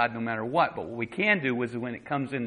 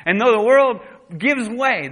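high, loud preaching burst; 10 semitones higher, 10 dB louder than usual. Real, not acted. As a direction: lift the line to a loud preaching peak. Preaching at full force.

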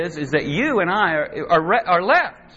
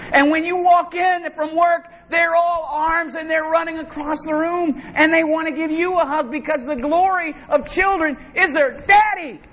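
normal talking voice

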